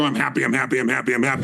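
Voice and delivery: low, unconvinced repetition; imitating a rote, joyless mantra; mock empty affirmation. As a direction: drone it like words you don't believe.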